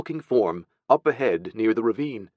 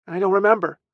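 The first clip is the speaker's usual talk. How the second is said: put-on high, reluctant child; pitched-up, sheepish child; little boy; 11 semitones higher, 3 dB louder, faster than usual. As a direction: dodge the question as a small boy. Small boy evades aunt's question.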